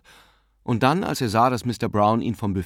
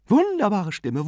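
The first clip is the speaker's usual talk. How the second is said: higher, admiring exclamation; enthusiastic praise; 11 semitones higher, 3 dB louder than usual. A narrator's reading. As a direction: gush about the singer. Aunt praises the tenor.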